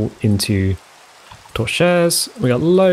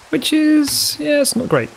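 squeaky dismay; slower than usual. Real, not acted. Builder with one torch left, squeaky mock dismay. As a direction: pitch jumps way higher in mock dismay.

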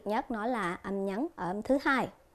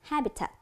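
"Habitat" with HA raised, the rest falling; stress on first syllable; 3 semitones higher, 3 dB quieter than usual. Shown as a demonstration. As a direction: punch the HA in "Habitat.". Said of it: "âm đầu tiên".

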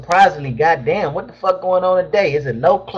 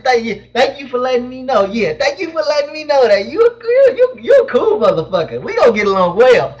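hammed-up crying voice, much higher; fake-sob your thanks to the viewer; mock tearful thanks; 6 semitones higher than usual.

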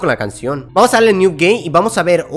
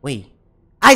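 ordinary talk, nothing marked

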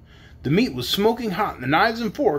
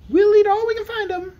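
very high, louder squeak; piglet voice; 5 dB louder than usual. A narrator's reading. Piglet keen on more fruit, high squeal. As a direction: squeal eagerly as a little pig. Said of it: "said another of the piglets".

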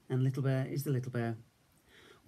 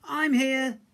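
reassuring call; 11 semitones higher, 6 dB louder than usual. A narrator's reading. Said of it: "said Big Bear".

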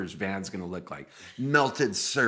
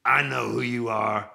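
loud singer impression, slowing down; 5 dB louder, slower than usual. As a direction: imitate him loudly, drawing out the last sentence. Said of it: "And he goes".

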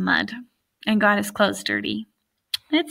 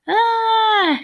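long, high, louder mock scream; falling cry; 12 semitones higher, 5 dB louder than usual. A narrator's reading. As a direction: cry out one sustained high "ah". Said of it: "cried the nine".

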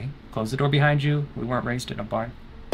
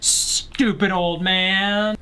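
slow jeer, pitched up; drawn-out taunt; 6 semitones higher, much slower than usual. Pretend. Player taunting Grandpa in the game, long drawn-out jeer. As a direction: stretch every word into a mocking taunt.

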